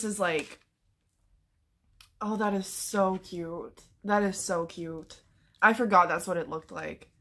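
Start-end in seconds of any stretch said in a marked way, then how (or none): 2.2-5.2 soft, tender awe. Real, not acted. Tender over end pages.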